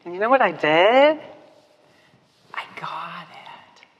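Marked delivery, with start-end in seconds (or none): 2.5-3.9 whispered, slow realization. Real, not acted. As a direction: breathe it as a secret. Hushed realization.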